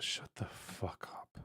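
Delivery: slow, unvoiced whisper; hushed whisper under the breath; whispered retort. Real, not acted. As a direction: whisper it very softly, drawing it out. Muttered retort to a chat comment.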